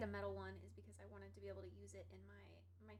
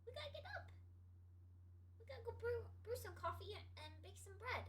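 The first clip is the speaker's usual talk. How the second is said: much higher sing-song coaxing; high pet voice; 10 semitones higher than usual. Real, not acted. Urging the cat Keys to get up, high pet voice. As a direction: wake the cat in a sweet high voice.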